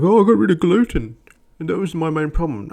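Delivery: high, loud mock boasting voice; mocking tennis player. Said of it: "And he's like".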